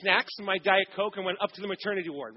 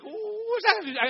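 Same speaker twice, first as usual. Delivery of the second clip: mock baffled; 13 semitones higher, much slower than usual. Husband baffled by nurse's question.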